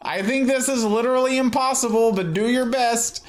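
high, squeaky cartoon voice; high character voice.

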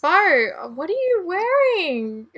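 high, loud cry of disbelief; appalled exclamation. Real, not acted. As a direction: call out aghast at the outfit. Appalled at Bo's new outfit.

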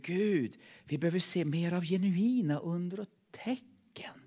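breathy, unvoiced plea to God; whispered prayer. Real, not acted. (viskad bön)

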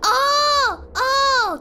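loud, high, drawn-out cry of realisation; shocked realisation. Real, not acted. Streamer grasping what the slang means, loud shocked realisation. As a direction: cry out as it suddenly dawns on you.

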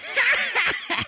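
high helpless giggling laugh. Acted. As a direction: laugh as if being tickled. Interrogated with a little of this.

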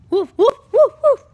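loud, high excited woofs; high pretend dog barks; puppy barking. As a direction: bark sharply like an excited little dog. Puppy barking in toy play.

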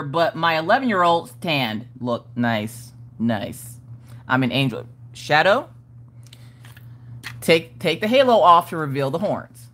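ordinary talk, nothing marked

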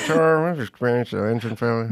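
lightly voiced calm pilot; steady, unbothered captain. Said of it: "And he went that".

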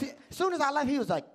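ordinary talk, nothing marked

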